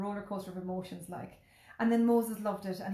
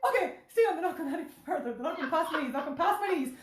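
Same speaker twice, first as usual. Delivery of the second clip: panicked self-talk; 7 semitones higher, 3 dB louder, faster than usual. Retold or imitated.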